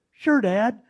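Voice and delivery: high, loud eager yes; son's eager reply. Retold or imitated. Father retelling son's keen answer, high and loud. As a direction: chirp it like a keen teenage son. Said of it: "He goes".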